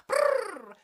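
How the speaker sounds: trilled brrr up high; high rolled purr. Acted. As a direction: purr like a cat. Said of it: "she purred".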